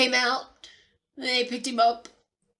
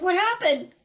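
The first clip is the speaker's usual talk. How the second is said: alarmed; 7 semitones higher, 4 dB louder than usual. Retold or imitated. Rushes in after loud fall.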